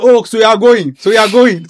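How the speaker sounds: high, loud mock taunt of the other person; hammed-up indignant retort. Mocking the other person's reaction.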